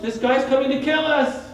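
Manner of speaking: high, loud frightened cry; acted fright. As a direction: play a panicked sailor spotting a stranger. Frightened disciples see a figure.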